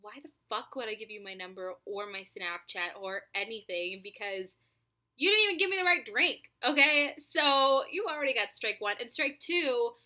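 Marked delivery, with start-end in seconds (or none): 5.2-9.9 louder, higher indignant rant; scolding the guy who got her order wrong. Real, not acted. Vlogger to the drive-through guy, indignant and louder.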